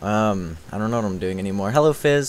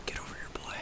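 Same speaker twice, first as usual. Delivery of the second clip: breathy whispered taunt; 21 dB quieter, much faster than usual. Shown as a demonstration. Taunting an enemy, whispered mock threat. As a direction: whisper the challenge. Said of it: "repeated taunt".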